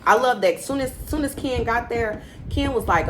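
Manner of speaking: usual speaking voice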